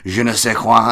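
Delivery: put-on showy French accent. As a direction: flaunt the French phrase with a French accent. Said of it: "to kinda show off their".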